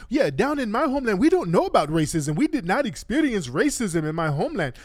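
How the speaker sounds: mock her with exaggerated rises and falls; mocking imitation of debate opponent. Host mimicking woman from Guyana in debate, mocking high voice. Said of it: "she was talking about".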